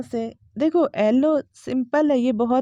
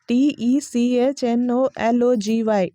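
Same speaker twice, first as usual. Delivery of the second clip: ordinary talk, nothing marked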